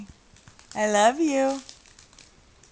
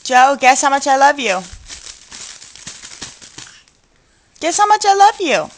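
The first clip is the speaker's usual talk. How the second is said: high, loud and fast loving sing-song; 5 semitones higher, 6 dB louder, faster than usual. Real, not acted. Loving playful baby talk.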